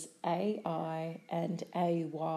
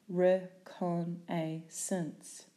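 "Reconnaissance" slowly, syllable by syllable; split into syllables; slower than usual. Shown as a demonstration.

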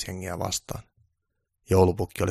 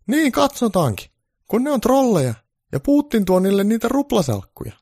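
odd granny voice, much higher; defiant old granny; 8 dB louder than usual. Acted. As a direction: play the granny standing her ground. Granny answering Santa.

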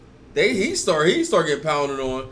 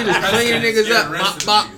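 laughing; 6 semitones higher, 7 dB louder than usual. Real, not acted. Laughing retelling fight.

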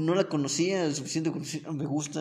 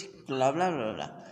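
ordinary talk

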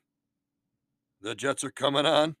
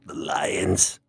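whisper; 6 semitones lower than usual. Acted. [name] whispers pick.

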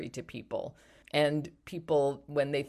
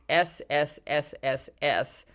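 long hissing s sounds. Shown as a demonstration. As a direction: hiss each s like a snake. Spelling out the phrase letters.

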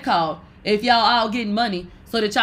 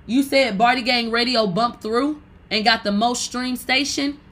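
ordinary delivery

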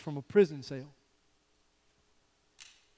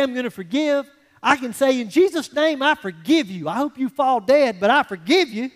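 louder, higher mock grudging forgiveness; sarcastic half-hearted forgiving; grudging forgiver; 5 semitones higher, 6 dB louder than usual. Churchgoer forgiving an enemy, mock grudging sarcasm. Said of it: "I mean, I would say".

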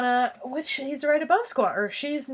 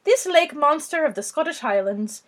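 mock Scottish accent. Reading about the monster on request, mock Scottish accent. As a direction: read it in a put-on Scottish brogue. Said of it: "read this in a Scottish accent".

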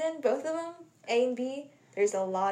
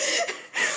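sharp high squealing laugh; real laughing squeal. Real, not acted. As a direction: burst out laughing. Laughing at Allison's death memory.

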